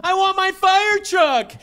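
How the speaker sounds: put-on high, slow whining child; whiny toddler. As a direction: whine like a toddler demanding a toy. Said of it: "sitting in his high chair going".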